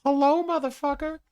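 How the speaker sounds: hammed-up high shout; Septim's sudden greeting. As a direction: pop in out of nowhere with a high, loud hello. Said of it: "Septim's just like".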